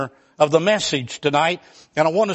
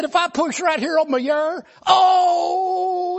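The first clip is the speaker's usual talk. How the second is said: high, tearful whine about pain; whimpering hypochondriac; 10 semitones higher, slower than usual. Retold or imitated. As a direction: whine like every touch is agony. Arzy moaning in pain.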